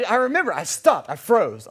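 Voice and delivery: ordinary delivery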